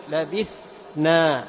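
very slow لبثنا, ث breathy before ن; modelling لبثنا; slow clear tha. Shown as a demonstration. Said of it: "توضحها عند النون".

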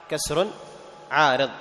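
ordinary talk, nothing marked

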